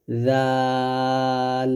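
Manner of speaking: জাল drawn out long and slow, tongue tip to upper teeth; Arabic letter demo. Shown as a demonstration. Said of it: "আমার সাথে আবারও বলেন". (জাল ধীরে টেনে উচ্চারণ)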